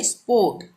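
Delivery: "स्पोर्ट" said "spawt"; silent r. Shown as a demonstration. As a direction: go from vowel straight to t in "स्पोर्ट". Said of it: "आर को silent कर देंगे". ("स्पोर्ट" को "स्पोट" बोलें)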